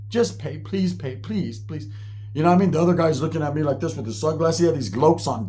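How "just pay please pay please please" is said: hushed begging. Retold or imitated. Begging friend under his breath.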